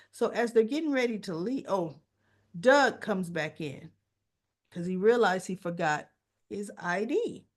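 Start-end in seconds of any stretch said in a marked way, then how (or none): none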